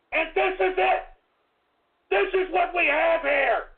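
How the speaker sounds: high, strained angry yelling; furious rant. Real, not acted. Host accusing a prime minister to listeners, angry high shouting. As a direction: hammer it out, outraged. Host keeps ranting at leader.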